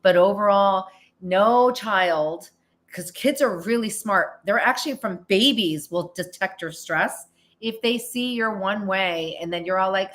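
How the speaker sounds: normal talking voice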